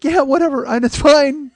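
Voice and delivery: very high, loud blubbering squeal; mock tearful dismissal. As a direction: imitate brushing it off while crying. Acts out himself laugh-crying.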